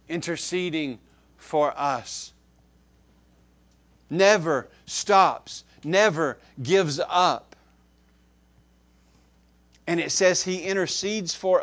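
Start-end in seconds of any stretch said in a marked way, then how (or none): 4.1-7.5 slow, louder, every word landed; emphatic and slow. Acted. Preacher assuring the flock the Spirit never quits, slow and emphatic. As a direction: hammer home that He never quits.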